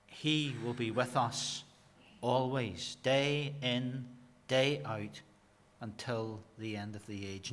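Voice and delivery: soft reassurance. Real, not acted. Gentle reassurance closing the point.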